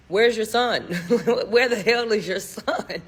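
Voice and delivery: amused demand, broken by a laugh; laughing incredulity. Retold or imitated. Guest wondering where the child is, laughingly incredulous. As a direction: laugh through the retold question.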